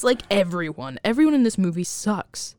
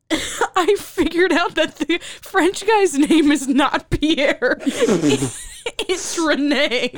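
laughing; 6 semitones higher, 3 dB louder, slower than usual. Real, not acted. Laughing at name mix-up.

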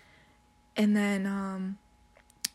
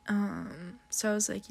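ordinary talk

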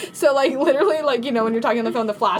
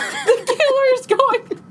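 much higher, slow, laughing panic; high panicky yelp; 13 semitones higher, 4 dB louder, much slower than usual. Retold or imitated. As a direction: squeal it in mock terror. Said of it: "I'm like".

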